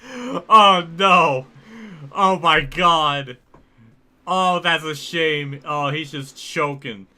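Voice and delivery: loud, drawn-out hammed-up dismay; mock dismay. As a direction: groan loudly as the favourite chokes. Larson struggling at the start.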